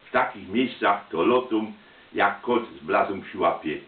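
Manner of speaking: ordinary delivery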